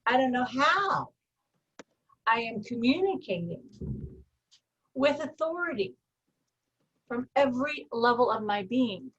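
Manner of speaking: slow, deliberate affirmation with long pauses; a calm, measured self-affirmation. Shown as a demonstration. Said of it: "So your command is".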